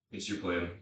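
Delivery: ordinary talk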